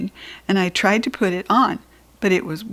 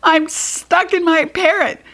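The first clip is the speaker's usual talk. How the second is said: high, frantic shouting; child stuck in swim ring panicking; panicked shout; 10 semitones higher than usual. Acted. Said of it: "I panicked and shouted".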